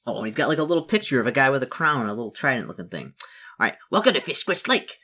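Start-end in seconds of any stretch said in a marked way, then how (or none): 3.9-5.0 high, loud goblin welcome; goblin chief. Acted. Big nasty goblin welcomes newcomer to the lake, goblin voice. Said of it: "says one of the goblins".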